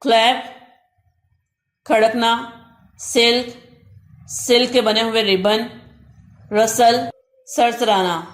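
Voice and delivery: ordinary talk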